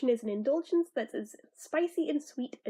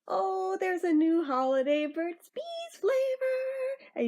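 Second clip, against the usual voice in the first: excited; 7 semitones higher, slower than usual. Real, not acted. Excited holiday product reveal.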